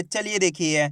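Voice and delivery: usual speaking voice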